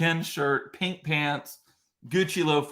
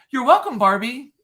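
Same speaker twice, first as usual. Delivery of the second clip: loud, higher snappy retort; sassy comeback; 9 semitones higher, 7 dB louder than usual. Retold or imitated. Dad answering the mocking woman, loud sassy comeback. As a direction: fire it back with cheeky pride. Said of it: "in a split second, I was like".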